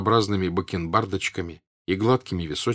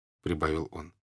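ordinary delivery, nothing marked

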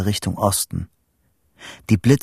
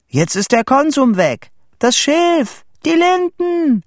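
high, loud, climbing higher with each item; shouting, building; 5 dB louder than usual. A narrator's reading. Boy telling Vito the village burns, shouting higher and higher. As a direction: call out each loss, rising to a peak.

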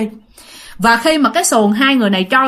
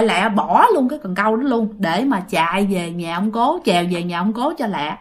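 plain reading voice

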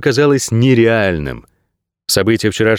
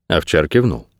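plain reading voice

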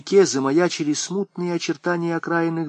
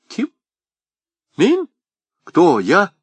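startled; 6 semitones higher, 3 dB louder, slower than usual. Acted. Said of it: "Аргылов вздрогнул".

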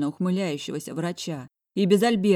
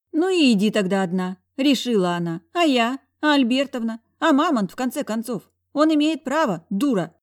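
angry yelling; 7 semitones higher, 7 dB louder than usual. A narrator's reading. Friend yells at heroine.

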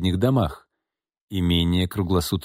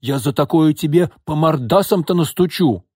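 exclaiming; 8 semitones higher, 5 dB louder than usual. A narrator's reading. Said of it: "воскликнула она".

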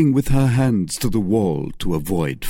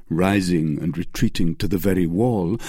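ordinary delivery

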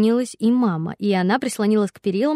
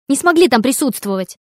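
raised indignant voice; heated arguing; 4 semitones higher, 6 dB louder than usual. A narrator's reading. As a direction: finish the jab loudly. Daughter ends her argument. (возмущённо)

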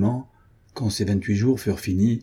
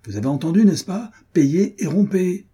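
furious order; 9 semitones higher, 5 dB louder than usual. A narrator's reading. Officer repeats order furious.

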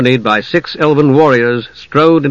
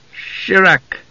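higher-pitched incantation; spell word; 6 semitones higher than usual. Acted. Mage calls light from the staff, lightly intoned spell.

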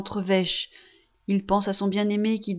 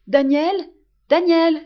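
much higher and louder, calling a name twice; 7 semitones higher, 8 dB louder than usual. Acted. Blind mother calls her son across the room, loud call. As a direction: call him across the room, arms open. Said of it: "en appelant".

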